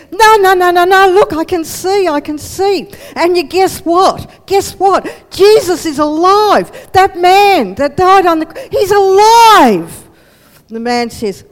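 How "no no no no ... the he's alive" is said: loud, high, bursting with joy; overjoyed exclamation. Acted. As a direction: shout it out like you just saw a miracle. Paul excitedly tells friend about Jesus.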